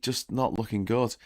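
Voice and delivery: ordinary delivery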